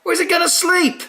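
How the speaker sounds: very high, loud, fussy squawk; grumpy broomstick voice. Acted. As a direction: object like an uptight broom. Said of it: "says the Broomstick".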